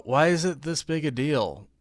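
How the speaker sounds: normal talking voice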